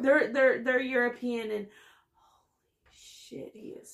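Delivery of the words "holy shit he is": breathless slow whisper of awe; awed whisper. Real, not acted. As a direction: whisper it, stunned by how hot he is. Awed at hot actor.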